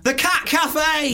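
high, stretched-out cry of inspiration; excited blurt. Real, not acted. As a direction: sing out the brainwave with glee. Blurts out the perfect venue.

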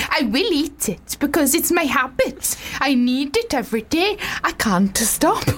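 put-on slow Welsh-accented quote. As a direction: keep the slow Welsh lilt. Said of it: "It was good Welsh".

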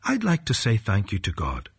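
plain reading voice

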